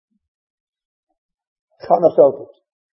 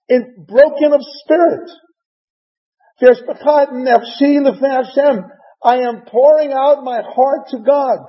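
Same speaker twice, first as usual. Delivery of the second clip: higher, rising passion; impassioned plea; 5 semitones higher than usual. Acted. Chana answering the priest Eli, impassioned plea. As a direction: pour out her heart to God. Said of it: "And Chana responds".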